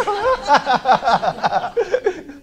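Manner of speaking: a loud high burst of laughter. Real, not acted. After quoting the repossession warning, loud laugh. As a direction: let the laugh out fully.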